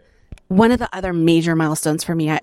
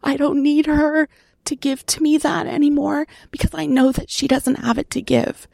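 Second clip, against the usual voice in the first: speaking through a real lump in the throat; choked up; 9 semitones higher than usual. Real, not acted. Sharing a painful memory of her mother, choked up. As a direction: voice pitched up and tight, holding back tears. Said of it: "I'm getting a little choked up on that".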